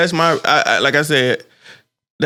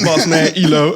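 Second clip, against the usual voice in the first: mock London slang; 4 dB louder than usual.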